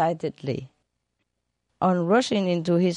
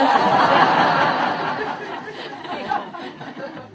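a high airy burst of laughter at the punchline; high breathy laugh; 13 semitones higher than usual. Real, not acted. Host laughing at her own joke.

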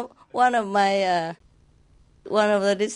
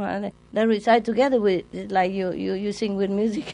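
normal talking voice

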